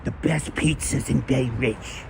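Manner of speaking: William DeMeo impression. Streamer finishing his impression for chat, slow Brooklyn voice. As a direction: drag it out like a Bay Ridge wiseguy. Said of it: "that's a William DeMeo voice".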